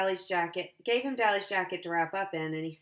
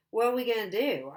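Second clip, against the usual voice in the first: crying; 5 semitones higher, 5 dB louder than usual. Acted. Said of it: "I was crying by then".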